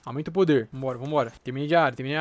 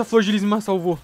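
ordinary talk

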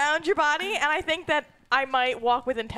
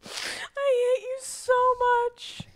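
mock exasperated; 9 semitones higher, slower than usual. Real, not acted. Player mock exasperated, trailing whisper.

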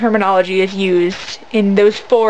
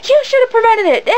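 high, accusing imitation of an irate mom; mock angry yelling; 11 semitones higher than usual. Imitating a mom blaming event staff, mock angry yelling. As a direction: scold in a mock outraged voice. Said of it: "she's, like, yelling at this guy".

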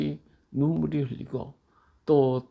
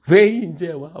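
put-on loud whiny 왜 이제 와 of a lost child; child's crying complaint; 4 semitones higher, 10 dB louder, slower than usual. A child to the mother who came home late, loud and whiny. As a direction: wail it like a child whose mom came late.

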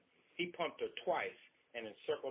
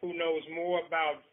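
angry retort; 3 semitones higher, 8 dB louder than usual. Acted. Husband answering his wife, a loud angry retort. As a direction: bite back hard.

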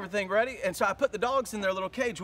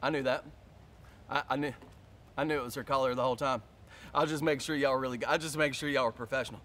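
embarrassed cover-up; 10 semitones lower, 5 dB quieter, faster than usual. Retold or imitated. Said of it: "I'm looking at this lady like".

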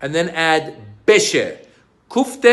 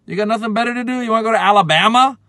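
higher, scoffing disbelief; scornful mock; 6 semitones higher, 3 dB louder, faster than usual. Scoffing at a friend's Alabama trip idea, higher scorn. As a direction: scoff at a silly plan.

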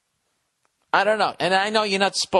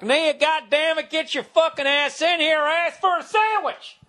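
hammed-up Southern voice; 12 semitones higher than usual. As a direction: holler it in a mock Southern accent. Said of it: "like, a Southern voice".

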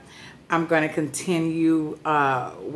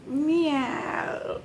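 high cat meow; playful meow; 10 semitones higher, 4 dB quieter than usual. Pretend.

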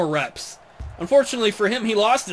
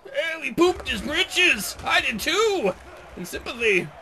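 higher, slow hammed-up old geezer; old man voice; 8 semitones higher, 3 dB quieter, slower than usual. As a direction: ham up an old codger. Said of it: "I can say phrases like that now".

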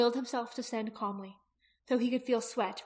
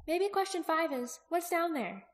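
put-on high girl's voice, posing a puzzle; questioning young Aki; 8 semitones higher than usual. Aki listing open questions for Jaqen, high questioning voice. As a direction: list the question in a raised pitch.

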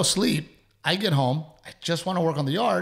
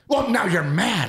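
mimicking wife, accusing; 7 semitones higher, 4 dB louder than usual. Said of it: "She's like".

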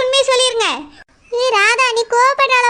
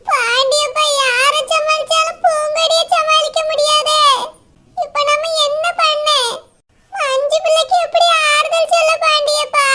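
put-on higher, tearful old woman lamenting; weeping grandmother; 5 semitones higher than usual. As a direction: wail over the family's shame. (அவமானத்தால் அழுது புலம்பும் பாட்டி)

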